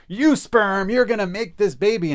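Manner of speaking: high, loud, hammed-up order; mock command. Would-be parent ordering conception, mock-forceful high shout.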